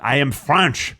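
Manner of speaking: put-on stilted French-accented English. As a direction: play a French person struggling in English. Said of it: "a French person trying to speak English".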